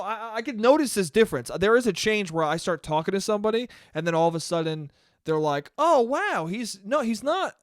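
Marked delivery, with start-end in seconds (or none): 5.8-7.6 higher-pitched mock amazement; lightly acted, wondering surprise; pleasant surprise. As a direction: imitate a stranger revising a first impression. Imitating strangers pleasantly surprised by him.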